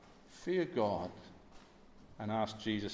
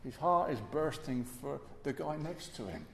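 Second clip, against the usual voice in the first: ordinary delivery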